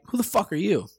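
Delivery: a lightly voiced rude insider's put-down; mock hostile snub; sneering Hollywood jerk. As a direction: toss out the challenge with contempt. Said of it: "exactly how they'll say it too".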